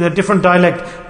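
ordinary delivery

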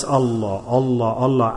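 low, soft murmured chant of devotion; quiet, low-pitched remembrance, as if in silence; hushed dhikr. Shown as a demonstration. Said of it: "do dhikr of Allah in silence".

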